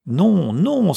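high, loud protest; alarmed cry. A narrator's reading. Clerk seeing brother board the carriage, alarmed cry. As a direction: cry out to stop him. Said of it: "s'écria Samson".

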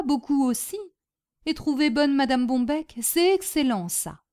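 lightly voiced foreigner in broken French; Boginski, earnest Polish storyteller. Polish refugee grateful to his hostess after the war, earnest. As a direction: recount hardship with simple contentment.

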